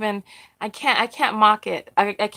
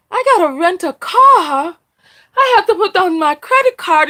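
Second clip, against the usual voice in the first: higher, louder acted outrage, stretching the key words; indignant disbelief; 5 semitones higher, 6 dB louder than usual. Speaker retells a mother balking at renting a car, indignant. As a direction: imitate someone appalled at the request.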